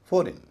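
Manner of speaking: फॉरेन as "FOR-in", high and quick; correct pronunciation. Shown as a demonstration. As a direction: punch the first syllable of फॉरेन, light "in". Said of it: "इन हो जाता है". (फॉरेन को "फॉरिन" बोलते हुए)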